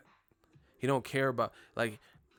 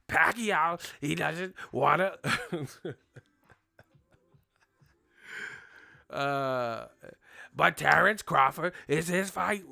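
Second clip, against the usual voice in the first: louder, higher grumbling answers; grumpy Bob Arum; 5 semitones higher, 5 dB louder, slower than usual. Retold or imitated. Bob Arum grumbling about Pacquiao and Crawford, mock grumpy. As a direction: grouch through it, clipped by laughs. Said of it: "You mad before I even hit the record".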